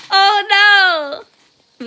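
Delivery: loud, high comic cry of alarm; mock dismay. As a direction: wail it in playful sympathy. Mock dismay at viewer's joke.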